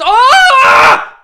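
sudden high yelp at a save; excited yelp. Real, not acted. Fan watching the match yelps at a saved chance. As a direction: jolt up at the chance. Fan reacting to a near goal.

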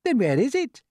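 high spider character voice; questioning Miss Spider. Acted. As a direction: ask it high and puzzled.

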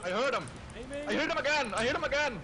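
high, loud squealed report; excited callout. Real, not acted. Player reports hearing the enemy, excited high cry.

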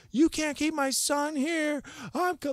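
high, loud imitated yell; frantic mom. Mom demanding her son back from partygoers, frantic yell. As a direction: demand it like a rescuing mother. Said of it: "why is she yelling like".

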